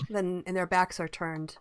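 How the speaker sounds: ordinary talk, nothing marked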